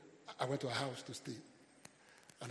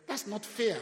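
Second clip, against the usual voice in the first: put-on child's protest; 8 semitones higher, 9 dB louder, slower than usual. Child objecting in story.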